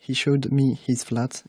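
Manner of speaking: slow teacher's example read; slow example sentence. Shown as a demonstration. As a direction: read slowly and clearly. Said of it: "plusieurs exemples".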